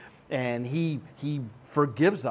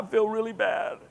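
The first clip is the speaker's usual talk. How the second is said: Clinton-like; 5 semitones higher than usual. Retold or imitated. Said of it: "That sounded like Clinton".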